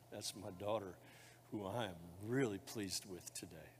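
soft, hushed fatherly voice; tender blessing. Acted. Pastor speaking God's blessing over the baptism candidates, soft and tender. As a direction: murmur it like a loving father.

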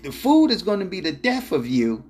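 imitating an annoyed wife yelling; retold yell. Wife yelling at husband over his eating, mock yell. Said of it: "she yelled out".

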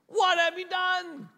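high, loud mock parental outburst; scolding parent yell. Preacher replaying his scolding for the parents listening, mock yell. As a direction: imitate a parent yelling in dismay.

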